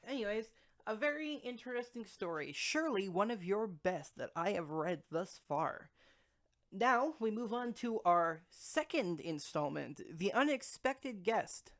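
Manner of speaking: slow, grand announcer voice; show announcer. Acted. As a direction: announce the next installment grandly. Host announces next installment.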